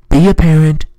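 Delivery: loud stern command; scolding shout. Real, not acted. Vlogger scolding parents on planes, loud stern shout. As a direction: yell it at careless parents.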